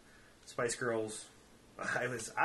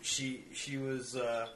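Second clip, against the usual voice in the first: usual speaking voice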